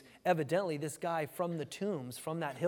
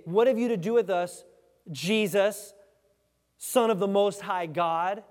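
raised, loud challenge with spaced phrases; demons' loud cry; 3 semitones higher, 7 dB louder than usual. Acted. Demons confront Jesus as they bow down, loud cry. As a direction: call it out, slowing on the title.

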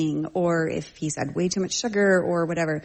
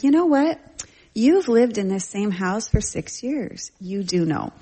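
higher-pitched, emphatic mother's retort; retold parental scolding; 4 semitones higher than usual. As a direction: call out the child's excuse, incredulous. Mother retorts to child's excuse.

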